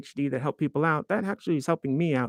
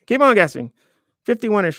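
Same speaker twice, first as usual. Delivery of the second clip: playful tease; 7 semitones higher, 6 dB louder than usual. Real, not acted. Teasing viewers about his age.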